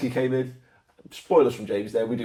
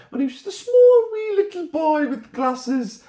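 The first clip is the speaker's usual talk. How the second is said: mock little boy; 4 dB louder than usual. Teasing a friend about his childhood.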